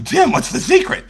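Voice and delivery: high, light mock voice of an eager fan; eager asker. People asking the speaker his secret, high mock voice. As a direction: pitch way up like a curious newbie. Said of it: "People ask me".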